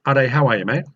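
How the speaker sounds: ordinary delivery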